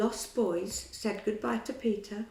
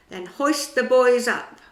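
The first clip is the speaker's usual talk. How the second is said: much louder commanding voice; captain's order; 4 semitones higher, 12 dB louder than usual. A narrator's reading. Hook orders his pirates to hoist the boys, in a commanding voice. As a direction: order the crew about.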